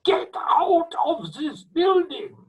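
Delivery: high, loud, drawn-out scream. Retold or imitated. Conductor screaming at first trombone Hotchkiss, high furious shout. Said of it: "Rudolf Schwartz screaming at Hotchkiss".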